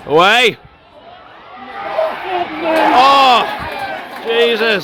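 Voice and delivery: high, loud shouts of relief; yelling from the sidelines. Real, not acted. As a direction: bellow it at the pitch. Clearing danger in the final seconds.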